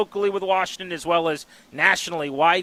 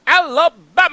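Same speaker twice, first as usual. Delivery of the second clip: big booming sportscaster shout, higher and louder; Keith Jackson call; 13 semitones higher, 9 dB louder, slower than usual. Retold or imitated. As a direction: belt it like a college football announcer. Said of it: "I always wanna say it like Keith Jackson".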